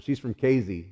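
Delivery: CASEY with a Z, KAY-zee; local pronunciation. Retold or imitated. Telling a college guy her town, Casey with a Z. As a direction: say Casey as Kayzee. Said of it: "and I said".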